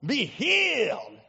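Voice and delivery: mock TV healer's dramatic command; Ernest Angley. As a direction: swoop up on a drawn-out healing command. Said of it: "and he would go".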